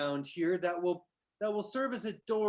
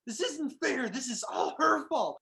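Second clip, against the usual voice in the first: crying satyr; 6 semitones higher than usual. Acted. Crying satyr blames her.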